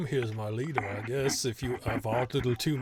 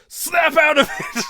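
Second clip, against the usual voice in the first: much higher, louder, slow shouted command; angry shout; 13 dB louder, slower than usual. Acted. GM voicing the prisoner yelling at his friend, loud shout. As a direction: bark it at your dazed friend. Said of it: "He goes".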